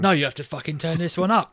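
acted heated insistence; forceful retort. Acted bullying retort to refusal.

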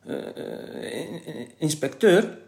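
halting stammer rising to a squeak; flustered, broken-up stutter. A narrator's reading. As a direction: stumble slowly into the word. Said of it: "stotterde Kees".

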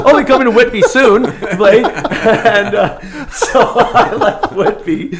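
high, loud words through laughter; laughing. Real, not acted. Joking about Whitby to friends, laughing loudly. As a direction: squeeze the line out while cracking up. Mock quote while laughing hard.

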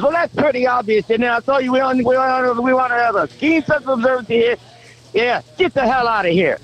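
mock boss flying off the handle at the applicant; angry outburst. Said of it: "the guy just flies off the handle".

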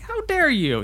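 squeaky-high affronted outcry; mock indignation. Imagined friend answering being called an asshole, indignant and very high. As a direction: play offended, pitch shooting way up. Acting out an offended reply.

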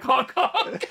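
mock clown honking; silly clown honk. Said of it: "been like".

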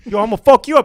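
mock threat. Brother fed up with the parrot, mock angry threat. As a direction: act out losing it with a pet.

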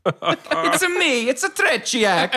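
put-on Mario-like Italian accent, laughing; mock Italian accent. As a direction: tease the friend's Russian accent as Italian. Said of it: "Russian accent".

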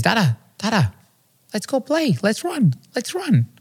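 put-on high-pitched, eager little-kid voice; imitated two-year-old son. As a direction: plead to play like a toddler. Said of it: "my two-year-old son, says".

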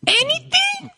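tiny, very high put-on voice; imitated eager kid. Host as Jamie answering dad's offer, mock-eager kid. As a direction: squeak it like a hopeful child. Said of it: "that's when he's like".

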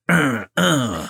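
grunting like a gym bro; short macho grunts with arm pumps. Retold or imitated. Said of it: "I'll just".

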